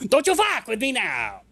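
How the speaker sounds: imitating the threat in a put-on voice; mocking the doctor. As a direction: parrot his threat back, mocking. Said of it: "mimics NPC line after laughing".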